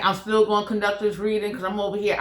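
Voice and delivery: normal talking voice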